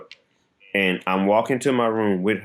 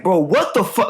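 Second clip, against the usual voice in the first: angry outburst; 10 semitones higher, 4 dB louder than usual. Retold or imitated. Angry at neighbor reflex.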